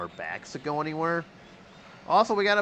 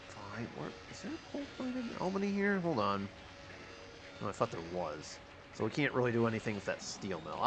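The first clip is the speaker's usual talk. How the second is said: grumbling to self; 8 dB quieter than usual. Real, not acted. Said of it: "just talking to myself here".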